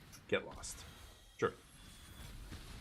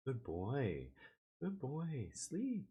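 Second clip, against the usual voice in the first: softer, tender praise for the dog; soft soothing; 4 semitones higher, 7 dB quieter, much slower than usual. Real, not acted. Streamer to his dozing dog Neo, soft tender praise. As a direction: coo him gently back to sleep.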